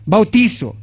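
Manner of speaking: ordinary talk, nothing marked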